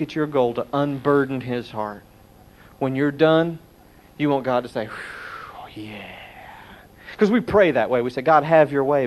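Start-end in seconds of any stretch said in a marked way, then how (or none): 4.9-6.2 breathy, much softer whew of relief; relieved sigh. Pretend. God relieved when the preacher is done, soft sigh. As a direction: let it out like a weight is lifted.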